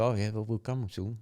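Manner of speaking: normal talking voice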